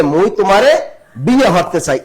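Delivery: loud, proud Barishal dialect: মই তোমারে with a rising end. Shown as a demonstration. বরিশালের আঞ্চলিক টানে জোরে বলা. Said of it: "বরিশাল আঞ্চলিক ভাষায় কি বলে?".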